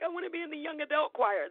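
put-on high, pleading child voice; eager kid. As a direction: ask like an eager little girl. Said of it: "So I was like".

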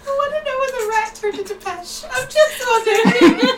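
high, laughing wondering; teasing high voice. Real, not acted. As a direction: squeak it out as a joke. Joking about rat's persona.

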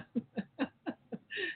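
short high amused laugh. Real, not acted. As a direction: laugh at your own point. Laugh after own joke.

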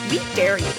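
normal talking voice

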